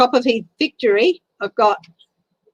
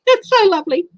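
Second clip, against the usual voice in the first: touched laugh; 11 semitones higher, 5 dB louder than usual. Real, not acted. Moved, laughing through tears.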